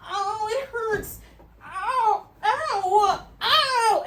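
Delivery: high, slow wailing ows; pained crying. Retold or imitated. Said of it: "And I'm like".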